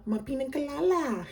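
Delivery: high-pitched imitation of a little girl; cute little girl. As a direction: mimic a cute child's way of saying it. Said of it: "Hella cute little girl".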